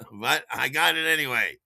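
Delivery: ordinary talk, nothing marked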